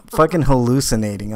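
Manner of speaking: ordinary talk, nothing marked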